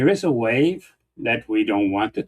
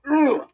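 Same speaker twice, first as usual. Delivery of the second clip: much higher mock groan of disgust; disgusted ugh. Imitating how the right reacts to Nixon, high disgusted ugh. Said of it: "the right would go".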